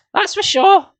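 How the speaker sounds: put-on a young girl chiming in, pitched high; Nicole agreeing. Nicole voiced in the story.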